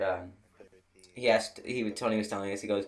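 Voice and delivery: ordinary talk, nothing marked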